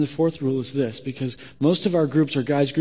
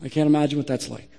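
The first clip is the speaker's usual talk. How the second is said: soft, spaced-out sympathy; gentle support; 4 semitones lower, 6 dB quieter than usual. Shown as a demonstration. Group member to someone who shared, gently supportive. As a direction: respond quietly, leaving room after each phrase. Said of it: "here's what you say".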